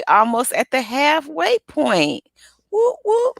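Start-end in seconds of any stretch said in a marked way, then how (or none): 2.7-3.4 high bright whoop whoop; playful victory whoop; celebratory whoop. Acted. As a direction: cheer the milestone.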